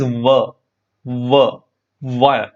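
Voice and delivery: "V" as a short "wuh", repeated slowly with pauses; isolated W sound. Shown as a demonstration. Teacher isolating the first sound of "one", "V" as "wuh". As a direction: sound out "V" as a bare consonant plus short vowel. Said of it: "The sound is".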